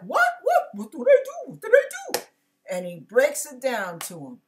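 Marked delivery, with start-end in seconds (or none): 0.0-2.2 much higher, startled what what; shocked disbelief. Retold or imitated. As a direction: caught out and protesting innocence. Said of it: "And David's like".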